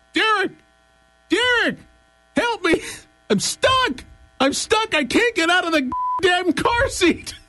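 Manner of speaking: put-on high, yelling little kid. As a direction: squeal it like a stuck four-year-old. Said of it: "you hear a little voice".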